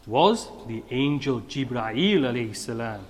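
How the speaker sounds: ordinary delivery, nothing marked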